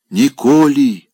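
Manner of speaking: медленно, высоко и громко; гордо, выпрямляясь. Acted. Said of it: "гордо произнёс он". (Опёнкин гордо отвечает бабушке, медленно и громко)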